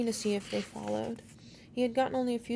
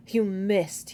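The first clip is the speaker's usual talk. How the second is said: grinning; 7 dB louder than usual. A narrator's reading. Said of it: "grinning for the first time".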